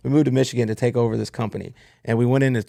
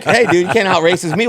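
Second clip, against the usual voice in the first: higher and louder, heated disbelief; retold heated comeback, voice raised; fired up retort; 7 semitones higher, 7 dB louder than usual. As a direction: push back hard, voice up and loud. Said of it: "I'm getting fired up".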